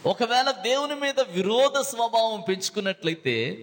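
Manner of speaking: ordinary talk, nothing marked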